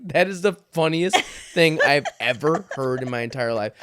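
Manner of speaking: words through real laughter; laughing. Real, not acted. Dad telling his daughter her remark is funniest ever, laughing. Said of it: "I'm dying laughing".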